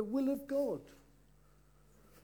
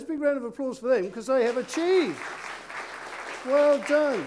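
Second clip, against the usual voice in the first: cheering praise; 4 semitones higher, 6 dB louder than usual. Real, not acted. Praising the boys to congregation.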